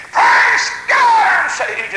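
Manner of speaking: high, loud revival shout; praise shout. Retold or imitated. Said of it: "And I'd shout".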